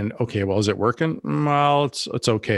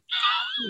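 a sudden high laugh that breaks out; 5 dB quieter than usual. Real, not acted. Laughing at her own bad movie accent, a high squeal.

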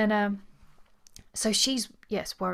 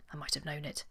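ordinary talk

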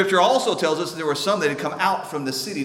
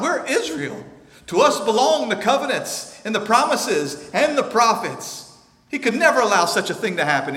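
high, loud, indignant insistence; scornful rising sermon voice; mock proud crowd; 9 semitones higher, 7 dB louder than usual. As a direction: preach their pride back at them. Preaching people's arrogance loudly.

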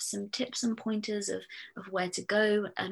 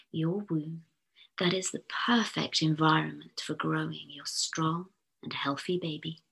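guided meditation. Acted. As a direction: lull the listener gently into calm. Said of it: "using my posh hypnotherapist's voice".

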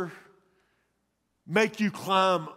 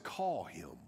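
soft commanding Jesus; 11 semitones lower, 12 dB quieter than usual. A narrator's reading. Jesus speaking in reading.

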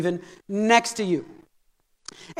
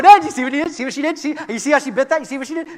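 eager tattletale; 2 semitones higher, faster than usual. Retold or imitated. Devil running to the Father to tell, tattling. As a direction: pester Dad with rapid-fire reports. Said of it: "fat tattletale".

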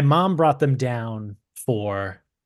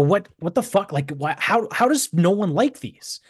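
1 incredulous outrage; 6 semitones higher than usual. Retold or imitated. Retold outrage at cookies.

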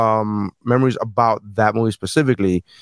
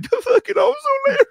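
high, wailing mock sob; hammed-up squeaky cry at the very top of the voice; Leonardo DiCaprio crying. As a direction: squeal it out like a weepy actor. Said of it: "he was like".

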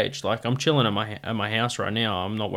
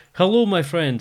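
put-on Russian accent; 5 semitones higher than usual. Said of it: "do the Russian voice instead".

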